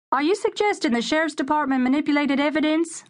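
high, called-out question from the crowd; reporter calling out. A narrator's reading. A reporter calls out to the lawyer, high and pushy. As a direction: call it out over the press crowd. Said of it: "a reporter called out".

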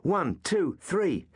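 high, counting beats aloud; called out. Acted. Suetonius counts blows for his friends, called out. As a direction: call the count like a drill. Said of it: "as Suetonius called out".

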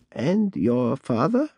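put-on creaky old voice. A narrator's reading. Bat Lady asks.

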